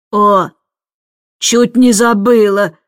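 slow, mildly booming call; thundering voice. A narrator's reading. Miss Andrew thundering from upstairs, slow and booming. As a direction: boom it out, drawing out the words. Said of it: "загремел наверху знакомый голос".

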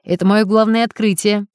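ordinary delivery

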